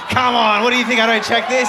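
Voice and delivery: loud, high, fast mock indignation; playful host scolding the panel, fast and loud; mock outrage. Real, not acted. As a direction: act offended that they doubt you.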